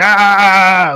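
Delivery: long, high, loud imitated roar; mock scream while shaking someone; enraged yell. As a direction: let out a drawn-out "aaah" of fury. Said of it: "picks him up and he's like".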